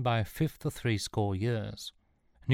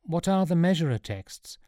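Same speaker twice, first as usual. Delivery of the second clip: high-pitched wondering question; wonderment; 8 semitones higher than usual. A narrator's reading. Sean asking the professor, in wonderment. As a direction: ask it in amazement. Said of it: "asked Sean in wonderment".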